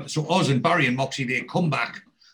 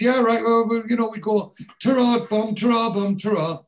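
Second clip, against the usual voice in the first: high-pitched, over-eager mock farewell; dodgy goodbyes; 10 semitones higher than usual. Guilty lads saying ta-ra to their roommate, imitated flustered goodbyes. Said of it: "Barry and Moxie look dodgy".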